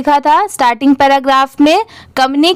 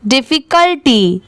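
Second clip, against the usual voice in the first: "Difficulty" run together as one quick word; at speed. Shown as a demonstration. Teacher showing the speedy reading, "difficulty" quick and joined. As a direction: read "difficulty" swiftly, without breaks. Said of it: "इसे speed में पढ़ते हैं".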